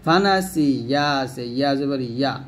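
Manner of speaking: normal talking voice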